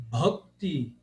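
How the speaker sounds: "bhakti" with aspirated BH and short dark vowel; Indian pronunciation. Retold or imitated. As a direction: say "bhakti" as "bhok-ti". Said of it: "eu ouvi a palavra". ("bhakti" com o H aspirado, à indiana)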